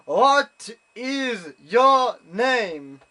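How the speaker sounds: very slow, high, booming shout, word by word; shouted repeat question. Retold or imitated. Judge to unresponsive German visitor, exasperated mock shout. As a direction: bellow it as if to someone who won't understand. He didn't respond.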